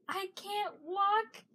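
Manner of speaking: high, drawn-out whiny complaint; put-on pitiful whine; mock whining. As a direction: whine it slowly and high, imitating a friend. Acting out Tom's complaint about shoes.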